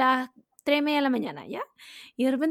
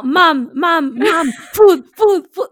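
talking cat; 9 dB louder, much slower than usual. Retold or imitated. Cat waking its owner at night, loud yowling plea. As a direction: yowl "food" like a starving cat begging at night. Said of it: "Imagínate en la noche escucháis como".

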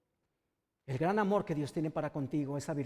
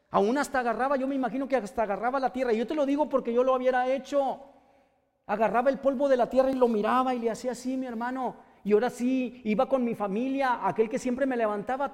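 louder, higher impassioned preaching; preaching at full force; 5 semitones higher, 5 dB louder than usual. Acted. Preacher tells the congregation of Bartimeo, fervent loud preaching. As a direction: build the sermon to a fervent climax.

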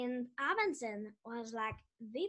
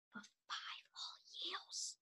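hushed dramatic whisper; 5 semitones higher, 15 dB quieter than usual. Acted. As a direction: whisper it for effect.

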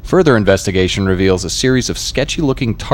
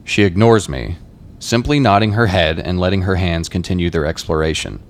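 normal talking voice

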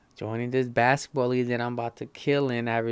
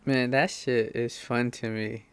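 ordinary talk

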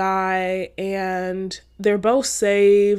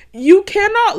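laughing; 11 semitones higher, 7 dB louder than usual. Real, not acted. Laughing mid-sermon.

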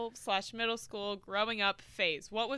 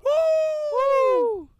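cheer; 12 dB louder than usual. Real, not acted. Cheering guest intro.

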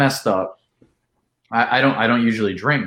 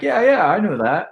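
playing it cool, high; 8 semitones higher than usual. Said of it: "trying to play it off".

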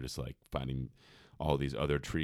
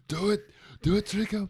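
much higher and louder, a hammed-up egging on of Trico; mock urging; 8 dB louder than usual. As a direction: cheer the creature on, squeaky and loud.

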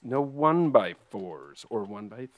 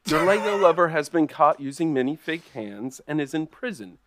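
usual speaking voice

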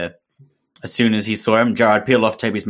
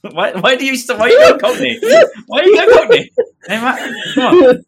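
high laughing exclamation; laughing protest; 8 dB louder than usual. Real, not acted. Mocking the Cockney reading, laughing hard. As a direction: laugh it out in disbelief.

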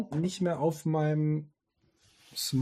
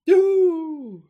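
cheer; 14 semitones higher, 10 dB louder than usual. Acted. Mock cheer after ticket info.

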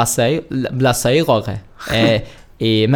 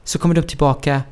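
normal talking voice

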